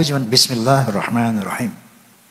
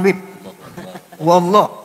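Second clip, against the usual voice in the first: ordinary delivery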